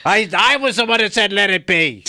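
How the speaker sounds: high, loud, hammed-up British old man. Co-host plays along as a Beatle's angry grandfather, loud mock accent. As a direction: belt it out as a badly faked cranky Liverpudlian. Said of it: "Now you're doing [name]'s Angry Grandfather".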